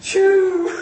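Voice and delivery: high, sudden "shoo" burst; imitated whoosh. Preacher telling of the prison doors bursting open, vocal whoosh. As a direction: make doors fly open with your voice.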